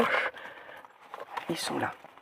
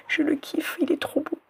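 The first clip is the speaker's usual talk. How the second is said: whispering; 7 semitones higher than usual. Real, not acted. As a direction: whisper it in delight. Excited whisper over a keychain.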